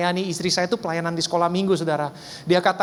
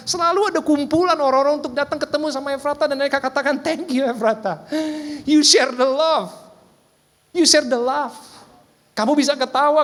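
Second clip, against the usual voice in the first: rising preaching; 8 semitones higher than usual. Acted. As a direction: lift the congregation toward praise. Preacher builds to applause. (khotbah yang makin berapi-api)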